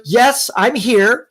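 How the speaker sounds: slow, drawn-out sulky reply; a lightly voiced sullen phone clerk, slowly; mock bored clerk. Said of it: "Like that".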